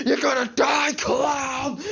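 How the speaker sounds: high, loud raging yell; imitated furious shout. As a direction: scream it at the heckler in a fury. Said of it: "and he says".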